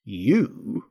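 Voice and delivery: one drawn, disdainful word; contemptuous. A narrator's reading. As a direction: dismiss the listener with a single word. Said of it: "said the caterpillar contemptuously".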